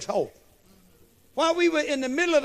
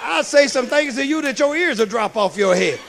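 loud, heated comeback at the woman; imagined angry retort; 3 semitones higher, 4 dB louder than usual. Retold or imitated. Preacher imagines shouting back at the golfer, loud and heated. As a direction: let her have it, shouting, as if ready to fight. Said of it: "I want to say".

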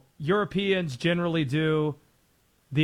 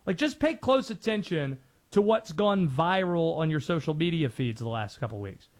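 normal talking voice

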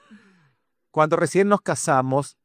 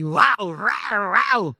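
dog barking; 4 semitones higher, much slower than usual. Shown as a demonstration. German pastor joking that he barks, mock dog barking. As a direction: bark like a German shepherd. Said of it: "a veces ladro".

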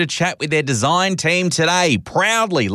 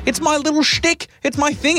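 pitched way up and playful; high, coy self-justifying tone; light hammed-up whimsy; mock high whimsical voice; 11 semitones higher than usual. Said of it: "And I go".

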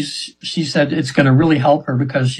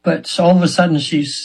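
ordinary delivery

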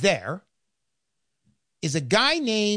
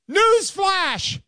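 mock headline shout; 11 semitones higher than usual. Announcing a headline.

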